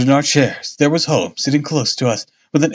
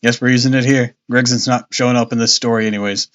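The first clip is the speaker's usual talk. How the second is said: usual speaking voice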